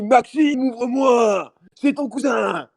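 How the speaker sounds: put-on high, loud drunken yelling through a door; mock drunk neighbour shouting. Drunk neighbour banging, retold.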